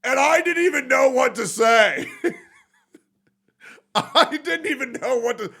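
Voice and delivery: high, loud laughing disbelief; laughing shout. Real, not acted. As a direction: crack up in disbelief.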